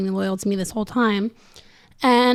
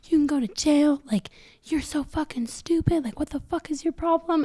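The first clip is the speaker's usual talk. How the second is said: friend scolding; 6 semitones higher, 6 dB quieter than usual. Retold or imitated. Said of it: "she's like".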